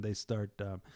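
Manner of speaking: usual speaking voice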